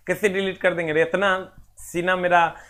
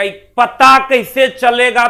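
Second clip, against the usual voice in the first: louder, higher slow question like a confused pupil; teacher mimics a puzzled student, loud and slow; 6 semitones higher, 9 dB louder, slower than usual. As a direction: play the student asking, drawn out. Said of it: "तुम कहेगा".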